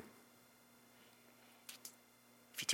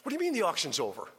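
incredulous; 5 semitones higher, 3 dB louder, faster than usual. Retold or imitated. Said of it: "People are incredulous".

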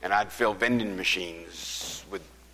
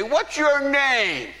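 calling out; 9 dB louder, much slower than usual. Retold or imitated. Shouted exchange across parking lot.

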